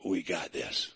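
a deep, easy reassurance from beside them; the Lord's voice. Retold or imitated. The Lord comforts the three in the furnace, low and soft. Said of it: "the Lord came to him and said".

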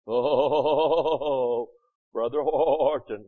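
low, bleating pious voice; mock sanctimonious church voice; goat voice. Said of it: "the goat voice comes in".

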